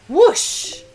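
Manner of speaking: a rising, loud whoosh. Acted. Broom zooming off, a loud rising whoosh. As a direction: sweep the word up like takeoff.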